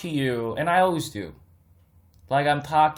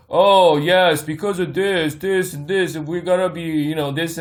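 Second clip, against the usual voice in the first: imitating a droning robot-like YouTuber, a touch louder at the start; hammed-up dull commentator voice; mocking a monotone finance vlogger; 2 semitones higher, 4 dB louder than usual. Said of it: "these monotone".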